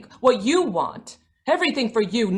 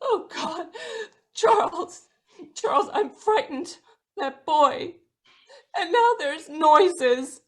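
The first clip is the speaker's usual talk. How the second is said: frightened, near tears; 5 semitones higher than usual. Acted. Frightened wife pleading with husband, near tears. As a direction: plead with him through tears.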